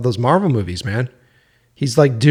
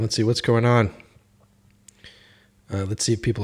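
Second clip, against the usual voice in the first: ordinary delivery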